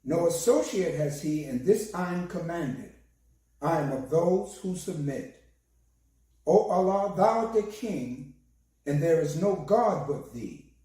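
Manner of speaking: normal talking voice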